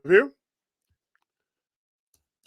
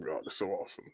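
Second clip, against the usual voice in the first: much softer and lower, almost to oneself; hushed praise; 8 semitones lower, 17 dB quieter, faster than usual. Real, not acted. Repeating praise over the damage photos, hushed praise. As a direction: let the praise sink to a private murmur.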